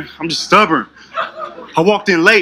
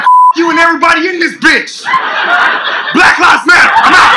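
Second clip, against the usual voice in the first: defiant outburst; 8 semitones higher than usual. Retold or imitated. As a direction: go out in a blaze. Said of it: "I was like".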